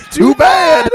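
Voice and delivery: playful shout. Real, not acted. Insisting on the impression.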